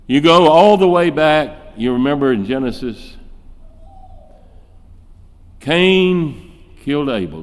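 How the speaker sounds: ordinary talk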